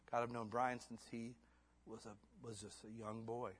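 ordinary delivery

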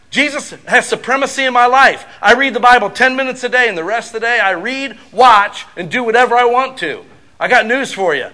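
loud, high preaching; preaching at full force. Real, not acted. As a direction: drive it home to the congregation. Preaching climax on love as time.

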